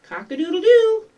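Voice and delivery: high, loud, brief rooster crow. Acted. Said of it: "You began the day with".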